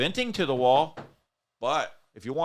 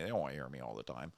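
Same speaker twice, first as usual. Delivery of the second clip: ordinary delivery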